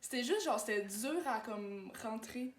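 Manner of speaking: shaky after a rush of nerves; adrenaline shakes. Real, not acted. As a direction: still trembling from adrenaline. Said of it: "check comment je tremble".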